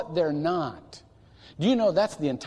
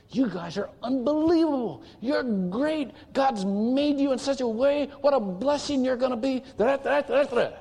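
put-on high, bright praise for his boys, higher throughout; enthusiastic cheering dad; 8 semitones higher than usual. Father cheering on his sons, high and excited. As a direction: pump the boys up like a proud father. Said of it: "I always told the boys".

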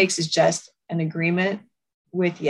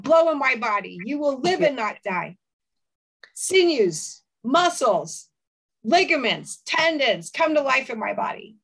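raised, slower prophesying voice; prophetic declaration; 6 semitones higher than usual. Shown as a demonstration. Speaker prophesying over herself, fervent declaration. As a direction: proclaim life over her own body. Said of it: "I will choose to prophesy to myself".

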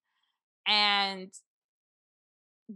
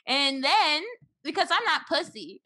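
ordinary delivery

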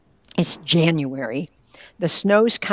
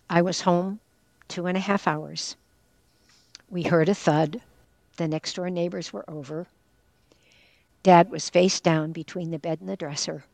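ordinary talk

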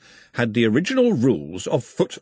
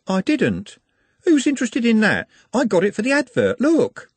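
put-on high, eager young woman, louder; bright girl pushing her point, higher and louder; 12 semitones higher, 3 dB louder than usual. Juliet voiced, young girl.

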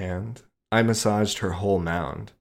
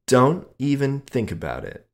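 teasing; 6 semitones higher, 4 dB louder than usual. A narrator's reading. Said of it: "she teased".

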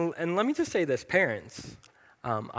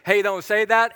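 loud, higher call-out; 6 semitones higher, 7 dB louder than usual. Retold or imitated. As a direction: stop them in their tracks. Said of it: "we will say".

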